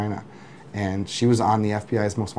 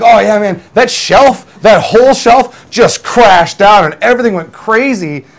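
much higher, louder, breezy bragging chef voice; carefree chef; 8 semitones higher, 11 dB louder than usual. Retold or imitated. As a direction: play the chef who proudly shrugs off a collapsed shelf, loud and high. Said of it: "He's like".

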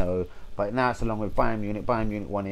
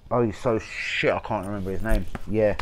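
ordinary talk, nothing marked

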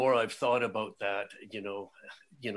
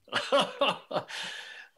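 emotional laugh; 14 semitones higher, 4 dB louder than usual. Real, not acted. Host's emotional laugh at himself.

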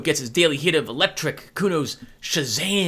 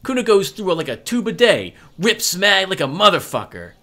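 put-on cocky kid voice, a bit higher and pushy; brash street kid; 6 semitones higher, 4 dB louder than usual. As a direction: show off like a foul-mouthed twelve-year-old. Kid Kuno brags loudly.

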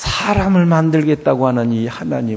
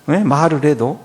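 normal talking voice

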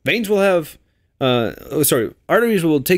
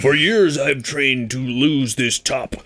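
slow, put-on dramatic declaration; mock anime hero; slower than usual. Spoofing a spinning-top cartoon, mock-heroic voice. Parodies a Beyblade character.

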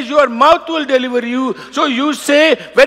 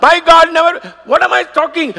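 ordinary talk

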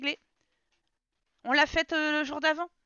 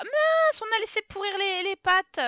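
higher, louder startled cry; surprised; 8 semitones higher, 5 dB louder than usual. Real, not acted. Player noticing the forgotten pasta, startled and high. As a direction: yelp it out in sudden dismay.

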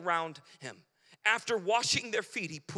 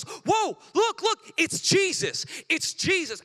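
loud, high, thrilled calling out; excited nun calling out; 9 semitones higher, 7 dB louder, slower than usual. Retold or imitated. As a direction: shout it like you've spotted someone across the street. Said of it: "She goes".

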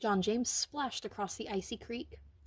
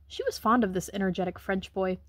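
usual speaking voice

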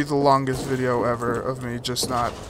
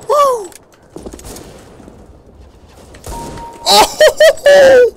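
much higher, loud joyful shout; excited whoop; 10 dB louder than usual. Real, not acted. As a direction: cheer the kill at full voice. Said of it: "I got a little excited there".

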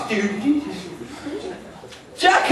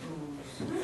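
ordinary delivery

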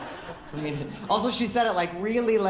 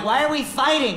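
higher, louder exasperated question; shouting; 8 semitones higher, 7 dB louder than usual. Retold or imitated. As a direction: yell it in the middle of the fight. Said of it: "And she was like".

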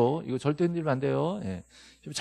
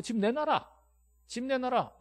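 mock demand; 9 semitones higher, slower than usual. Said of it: "상대방한테".

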